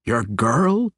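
can't believe what he hears; incredulous. Acted. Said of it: "Brunner asked incredulously".

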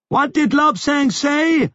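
high shout over the roar. A narrator's reading. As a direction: shout to make yourself heard. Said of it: "He had to shout to make himself heard".

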